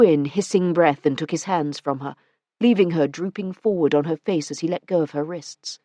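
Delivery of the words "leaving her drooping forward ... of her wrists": ordinary delivery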